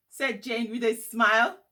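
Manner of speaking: plain reading voice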